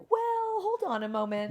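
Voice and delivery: high-pitched "well" of doubt; imitated inner voice, piping up high; little inner voice. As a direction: voice a cautious gut feeling interrupting. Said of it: "that little voice that's like".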